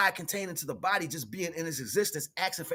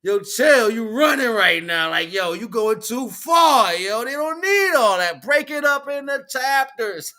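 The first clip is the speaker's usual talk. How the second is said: louder, slow, raised voice telling him to chill; loud slow warning; 5 semitones higher, 9 dB louder, slower than usual. Retold or imitated. Retelling friend Devon warning him he went too far, loud and slow. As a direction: rein him in, loud and drawn out. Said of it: "Devon was like".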